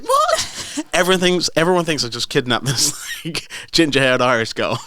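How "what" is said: squeaked far higher, with a laugh; shocked what. Retold or imitated. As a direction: disbelief jumping way up in pitch. Shocked at child's lie.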